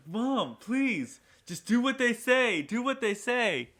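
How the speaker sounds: a scared son pleading to comply with robbers; panicked pleading. Retold or imitated. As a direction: beg mom frantically in a strained high voice. Son retold pleading with mom.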